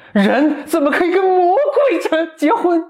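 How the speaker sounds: high, slow scornful prince, laughing; hammed-up outrage at the devil; mock indignant prince.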